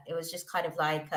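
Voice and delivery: ordinary talk, nothing marked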